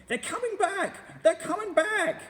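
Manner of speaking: very high, loud, slow alarm call; screaming. A narrator's reading. Roly-poly bird swooping in, screaming the alarm. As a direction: shriek the warning to everyone.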